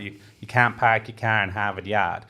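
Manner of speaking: put-on light Boston accent, r's dropped; a hint of Boston, dropping the r's. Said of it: "you said it in an American accent".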